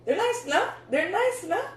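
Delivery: high, pleased, showing off the shoes; proud of the find, voice jumping up; excited showing off. Real, not acted.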